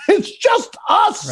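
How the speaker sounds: high, slow, laughing plea; laughing protest. Retold or imitated. Comic reminding Otto nobody else is there, high laughing plea. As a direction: laugh it out pleading. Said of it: "I go".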